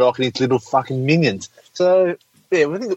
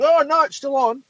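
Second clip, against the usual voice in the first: high mock whine of a complainer; mock whiny moaner; 13 semitones higher than usual. Mocking an online moaner about lockdown, high whiny voice. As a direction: squeak it out like a clueless whinger. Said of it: "this fucker's like".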